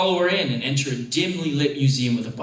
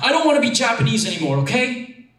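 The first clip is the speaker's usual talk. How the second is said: fast, loud blurted outburst, pitched up; angry outburst; 7 semitones higher, 4 dB louder, faster than usual. A narrator's reading. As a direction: blurt it out, louder and faster. Teen outburst at mom.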